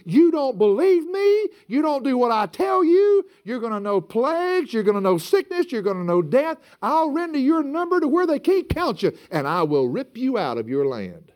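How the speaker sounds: high, forceful voice of God giving warning; stern God. Acted. God warns disobedient Israel of coming judgment, stern. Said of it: "what did God say?".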